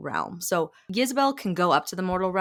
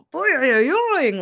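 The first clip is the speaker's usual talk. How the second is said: high, loud boi-oi-oing; eyes-popping cartoon spring sound, pitched way up; cartoon ogling noise; 10 semitones higher, 8 dB louder than usual. Demon first sees the hot witch, cartoonish goggle-eyed boing. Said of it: "eyes coming out of the head".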